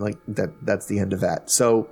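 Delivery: usual speaking voice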